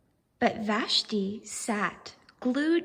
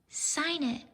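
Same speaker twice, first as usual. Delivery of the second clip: soft, higher, encouraging; gentle; 5 semitones higher, 4 dB quieter than usual. Acted. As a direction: tell the boy kindly. Vashti gently tells the boy.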